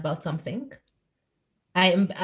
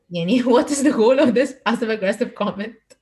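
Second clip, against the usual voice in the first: laughing; 3 semitones higher, 7 dB louder, slower than usual. Real, not acted. Laughing while asking the question.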